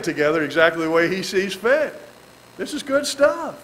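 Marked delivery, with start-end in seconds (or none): none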